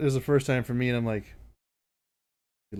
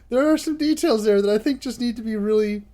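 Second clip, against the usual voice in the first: loud, high, amused insistence; laughing while talking; 8 semitones higher, 7 dB louder than usual. Real, not acted. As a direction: insist loudly through bubbling laughter. Laughing while demanding details of a meme.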